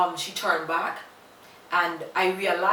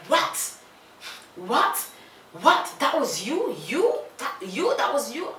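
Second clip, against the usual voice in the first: mock shocked cry, pitch jumping higher; retold shocked scream; 6 semitones higher than usual. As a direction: replay her stunned disbelief with a mild yell. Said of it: "she screamed".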